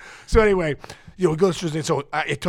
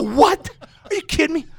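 much higher and louder, incredulous; mock outraged disbelief, higher; shocked disbelief; 12 semitones higher, 5 dB louder than usual. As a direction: react as if you can't believe it. Shocked at smelling himself.

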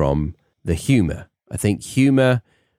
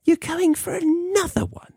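high-pitched mock disbelief at yet another massage; mimicking Stacey. Partner teasing about yet another massage, high mock disbelief. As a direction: squeak it out like an incredulous partner. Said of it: "Stacey was like".

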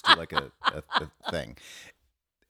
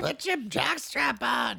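mock shout; slower than usual. Heckling shout in the bit.

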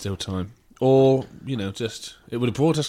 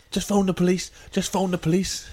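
pitched up, urgent pleading; pleading woman; 6 semitones higher than usual. Retold or imitated. Woman at a kebab shop counter, urgent pleading. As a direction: beg them to ring the police. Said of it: "one of the girls was saying".

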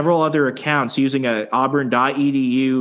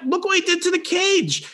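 high, squeaky mock alarm; retold panicked pointing-out, pitched way up; flustered protest. Guest showing the owner the damage, flustered.

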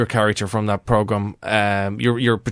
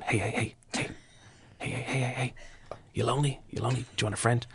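hushed, breathy hey-hey-hey, much softer; creepy whisper; 11 dB quieter, faster than usual. Retold or imitated. Man creeping up on a woman at a bus stop, whispering. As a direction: whisper it creepily behind her. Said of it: "just whispering".

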